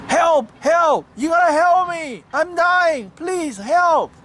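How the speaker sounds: high-pitched frantic cries of a stranded scorpion; desperate yell for help. A narrator's reading. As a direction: yell it out in urgent high bursts. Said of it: "the scorpion yelled".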